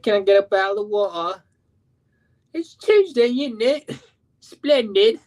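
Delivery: very high, hammed-up British voice; joke British accent. Shown as a demonstration. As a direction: put on a silly high-pitched British accent. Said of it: "I tried to do a British accent".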